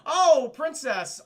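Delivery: loud, high, drawn-out "oh"; hammed-up sheepish surprise; mock startled greeting. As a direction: act caught out, stretching the words. Acting Malgus seeing the princess alive.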